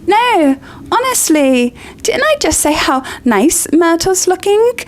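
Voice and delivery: high, flustered denial; flustered insisting. Acted. Hermione insists to Myrtle, high and flustered. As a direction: protest too much.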